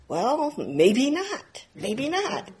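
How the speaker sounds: high, slow, drawn-out hesitation; slow doubt. Retold or imitated. Student doubting his sudden enlightenment, slow and hesitant. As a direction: let the doubt creep in slowly.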